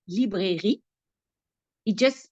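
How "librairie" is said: librairie in French, lee-breh-ree with a French r; French pronunciation. Shown as a demonstration. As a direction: say librairie the French way, three clear syllables. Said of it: "But in French, it's the same word".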